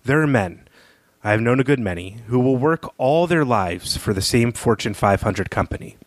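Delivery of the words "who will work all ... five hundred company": ordinary talk, nothing marked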